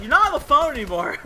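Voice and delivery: high, loud mock scolding; imitated yell. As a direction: mimic an indignant yell. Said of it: "She'd, like, yell at me".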